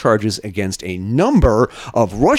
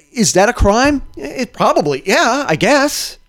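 incredulous; 9 semitones higher than usual. Real, not acted. Incredulous rhetorical questions.

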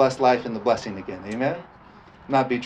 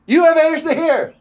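much higher, loud proclaiming shout; Jesus shouting; 9 dB louder than usual. Retold or imitated. Preacher imitates Jesus grabbing attention with a shout. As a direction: call out to the crowd like a street preacher. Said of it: "Exclamation point".

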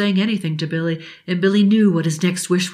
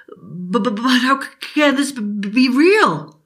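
put-on higher, breathless stammering boy; stuttering boy; 6 semitones higher than usual. Billy asking the genie how this can be real, stammering. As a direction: trip over every word in wonder.

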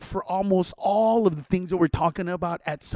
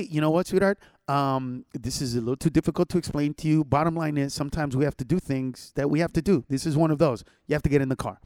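put-on lower, soothing but firm with the child; gentle parent; 6 semitones lower than usual. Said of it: "let's say".

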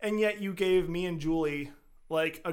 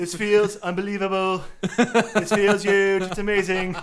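mock Trump in bed; 3 semitones higher, 10 dB louder than usual. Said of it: "we both".

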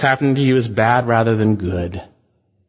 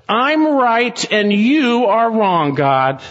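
higher, slow, defiant Jonah insisting he's right; Jonah's defiance; 9 semitones higher, much slower than usual. Retold or imitated. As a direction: spell out Jonah's stubborn claim word by word. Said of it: "Jonah's saying, basically".

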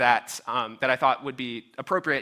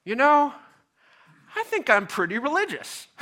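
high, bright showing-off of piety; a pleased, self-satisfied religious brag; mock pious boast; 11 semitones higher than usual. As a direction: parody a smug churchgoer bragging. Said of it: "those who would say".

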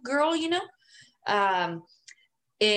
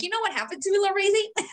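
high, squeaky little voice; princess voice; 10 semitones higher, faster than usual. Pretend. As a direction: go high and girlish, mocking your own voice. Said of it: "Meanwhile, I'm like".

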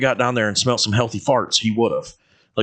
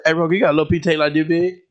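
fast, higher garbled request; rushed jumbled question, pitched up; mimicking the coworker; 7 semitones higher, much faster than usual. Imitating the coworker asking him for tape. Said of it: "she's like".

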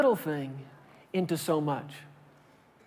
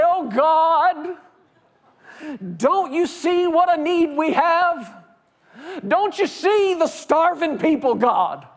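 desperate begging prayer; 7 semitones higher than usual. Retold or imitated. Preacher acting out a desperate plea to God. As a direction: plead with God like a starving man.

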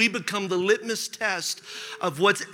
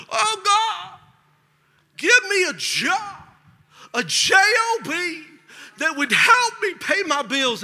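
high-pitched, drawn-out pleading to God; hammed-up begging in prayer; mock desperate prayer; 9 semitones higher, slower than usual. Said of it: "We prayed in twenty-one days".

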